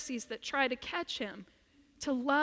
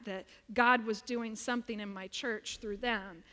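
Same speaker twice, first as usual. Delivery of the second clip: ordinary talk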